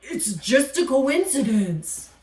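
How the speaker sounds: a gruff alligator defending himself; grumpy alligator voice. Acted.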